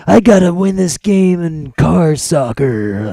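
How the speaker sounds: slow, high, loud mock action-hero line; movie hero line. Pitching a Rocket League film, mock hero voice. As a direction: ham it up like a cheesy sports movie hero. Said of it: "You throw some characters in there".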